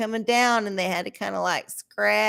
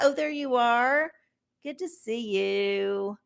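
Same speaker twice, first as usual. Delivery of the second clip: normal talking voice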